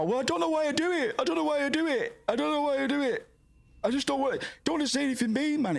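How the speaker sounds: high, whiny mock of someone making excuses; high whine, mocking someone who won't be honest. Mocking people who flatter friends, in a high whiny voice. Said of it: "And I've heard people go".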